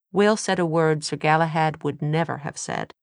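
usual speaking voice

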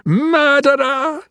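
very high, drawn-out cry; long, high-pitched theatrical scream; screamed accusation. A narrator's reading. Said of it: "he screamed".

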